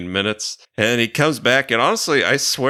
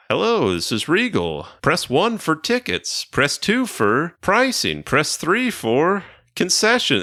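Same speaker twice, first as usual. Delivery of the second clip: higher, slow, robotic menu reading; automated menu voice; 6 semitones higher than usual. Retold or imitated. Imitating the theater's automated phone menu, slow and high. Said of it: "an automated".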